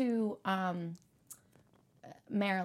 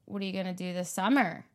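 Western Pennsylvania tune; faster than usual. Shown as a demonstration. Philly speaker imitates how Western Pennsylvania asks, falling tune. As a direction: ask "What are you gonna do this summer?" the Western Pennsylvania way, dropping on "summer". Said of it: "inflection is different".

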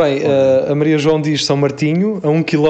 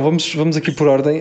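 ordinary talk

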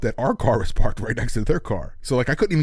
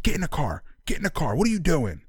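retold urgent order; 7 semitones higher than usual. Friend hustles gunman to the car, higher urgent voice. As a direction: urge him along in a raised pitch.

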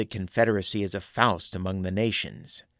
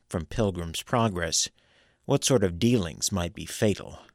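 plain reading voice

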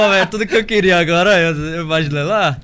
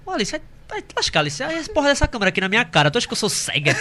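higher, half-laughing scolding; mock exasperation; 5 semitones higher, 4 dB quieter, faster than usual. Imitating himself catching girlfriend's fake choking prank. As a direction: scold her while laughing. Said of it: "Aí eu".

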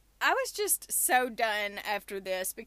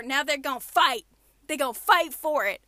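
fired-up rant; 8 dB louder than usual. Real, not acted. Ranting about bidding wars.

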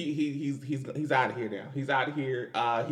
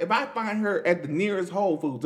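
usual speaking voice

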